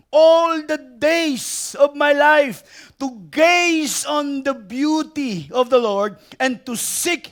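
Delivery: drawn-out, reverent psalm reading, slow; solemn pulpit recitation, slow; slow scripture reading. Acted. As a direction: read the verse slowly, savouring each word. Reads the psalm slowly.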